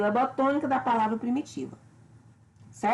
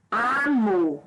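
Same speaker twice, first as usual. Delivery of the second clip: "Amor" drawn out as a stress example, MOR tonic. Shown as a demonstration. Teacher contrasting tonic and unstressed syllables, "Amor" stressed on MOR. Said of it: "mor aqui sendo a sílaba tônica".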